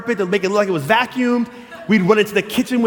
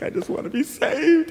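put-on earnest young self; 8 semitones higher, 4 dB quieter than usual. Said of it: "and I was like".

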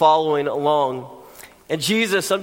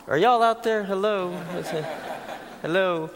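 teasing the crowd; 6 dB quieter than usual. Real, not acted. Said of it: "That was a good line, and you missed it".

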